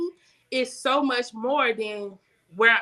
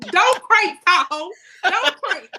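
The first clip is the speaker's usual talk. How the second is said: high, loud playful plea; begging the car not to start, laughing; laughing pretend plea to a Tahoe; mock pleading with the car; 8 semitones higher, 7 dB louder, slower than usual.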